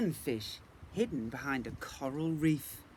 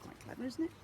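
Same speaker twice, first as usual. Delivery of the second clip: impressed; 11 semitones higher, 4 dB quieter, faster than usual. Real, not acted. Marvelling to child.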